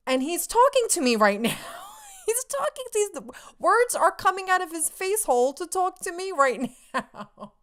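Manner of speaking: high, excited squeal breaking into laughter; giddy disbelief. Real, not acted. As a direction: gush in thrilled amazement, laughing. Reliving idol recognising and addressing her.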